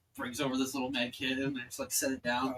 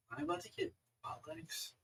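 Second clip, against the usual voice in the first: awed hush; 13 dB quieter, faster than usual. Acted. Awed patient asks about new legs.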